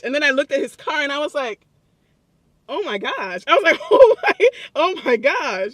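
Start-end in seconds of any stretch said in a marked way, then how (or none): 2.7-5.7 much higher and louder, building to a squeal of amazement; amazed gasp. Retold or imitated. Telling viewers how she reacted to his car, squealing in amazement. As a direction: gush over his car in disbelief.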